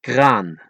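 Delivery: Kraan with no puff on the K; unblown K. Shown as a demonstration. Teacher showing Dutch letters, Kraan with unblown K. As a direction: say Kraan in Dutch, K cut short. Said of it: "without blowing those three letters".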